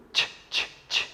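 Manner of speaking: whispered Ch, ch, ch; unvoiced Ch, ch, ch hissed out; soft breathy Ch, ch, ch; letter sound ch. Shown as a demonstration. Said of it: "is a chicken".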